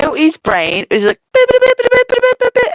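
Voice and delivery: high, rapid "bit-bit-bit" babble; imitated brain chatter. Streamer acts out a brain working, high rapid babble. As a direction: rattle off high-pitched mock brain static.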